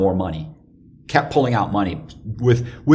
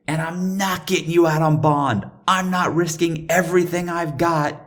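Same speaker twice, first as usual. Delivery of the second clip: high, insistent imitated refusal; adamant girlfriend; 8 semitones higher than usual. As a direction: imitate her laying down the law, pitched up. Girlfriend refusing to help him.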